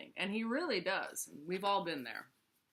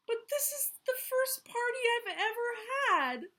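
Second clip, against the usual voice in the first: crying out; 4 dB louder than usual. Acted. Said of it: "cried Moose".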